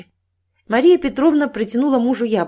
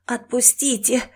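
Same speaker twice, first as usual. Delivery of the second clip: strained, higher hoarse begging; weak, croaking cry; hoarse plea; 4 semitones higher than usual. Acted. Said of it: "прохрипела Наташка".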